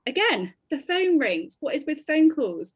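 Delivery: fed up, voice climbing high and loud; exasperated. Real, not acted. Yet another bad call arrives.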